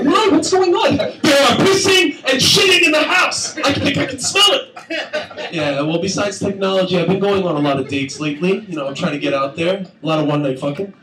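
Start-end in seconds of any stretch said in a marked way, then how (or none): 0.0-5.5 panicked guest. Retold or imitated. Dinner guest shocked at an indoor toilet, high outraged. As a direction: shriek it in disgust.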